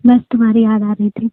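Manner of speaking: ordinary talk, nothing marked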